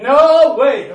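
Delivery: loud, high laughing no way; laughing outburst at a sermon's question; excited shout. Retold or imitated. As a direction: blurt it out in delight. Answering preacher's question aloud.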